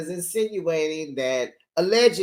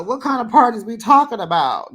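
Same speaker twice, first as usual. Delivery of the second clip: incredulous; 7 semitones higher, 6 dB louder than usual. Retold or imitated. Continues outraged retort.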